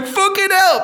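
high, loud mock-drowning yell; cry for help. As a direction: call out for help from far off. Drowning man calling from sea.

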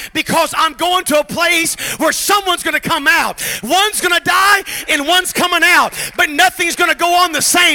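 shouted, high preacher's cry; full-force preaching. Acted. Pastor rallying church against their lion, shouted preaching. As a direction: shout it to the rafters.

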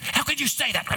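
a retold mother flaring up in disbelief; mock outraged mother. Mother hears she is loved and flares up: high indignant cry.

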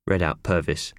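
plain reading voice

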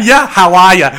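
high, loud mock Boston accent. Guest echoing the coworker in a Boston accent. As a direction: ham up a Boston greeting. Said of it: "he had that".

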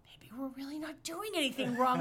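hushed, nervous whisper; whispered inner thought. Retold or imitated. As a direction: whisper it to yourself in worry. Paranoid stoned inner voice.

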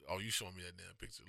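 usual speaking voice

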